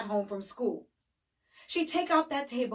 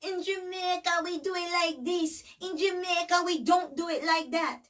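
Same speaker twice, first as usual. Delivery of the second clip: high, mocking Jamaican accent; mimicked Jamaican accent; 6 semitones higher than usual. Woman fed up with Trudy's sayings, mocking Jamaican accent. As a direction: throw their words back in a put-on Jamaican accent. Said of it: "I've put up with your".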